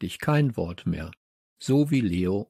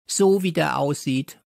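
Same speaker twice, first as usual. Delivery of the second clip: higher, mildly screechy jibe; shrieking; 5 semitones higher, 3 dB louder than usual. A narrator's reading. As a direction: toss it out in a raised voice. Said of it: "kreischte Stella wieder".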